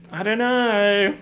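loud, high, drawn-out cry of bafflement; high, slow wail of not knowing; exasperated wail. Acted. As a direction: stretch it out high and loud, at a loss.

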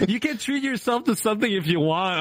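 high-pitched mock husband; imitating husband. Streamer mimicking husband's offer, mock coaxing. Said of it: "And then he says".

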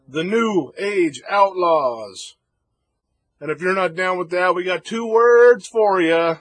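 booming ring announcer, loud and high; ringmaster. Retold or imitated. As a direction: announce it to a packed arena. Ring announcer introducing tag champions.

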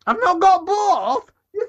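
high, drawn-out protest; indignant. Real, not acted. Answering co-host's objection about both, indignant. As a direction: throw the accusation back, pitched high and slow.

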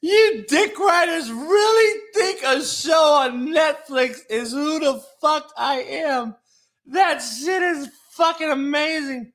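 loud, high-pitched shout at the fans; shouting rant. Real, not acted. Yelling at fans who judge him by a Netflix show, loud high rant. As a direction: yell it at them, fed up.

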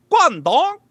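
exaggerated high Chinese tones. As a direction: mimic a woman's very high recorded voice. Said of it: "it's a higher pitch".

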